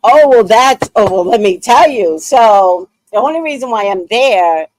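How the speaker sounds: loud, high burst into the story; excited. Real, not acted. Telling viewers the story, loud and excited. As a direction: jump in all fired up.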